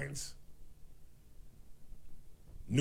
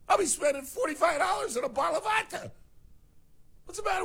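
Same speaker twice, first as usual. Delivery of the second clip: outraged disbelief. Real, not acted. Host outraged by tariffs on his vodka, high squealing disbelief.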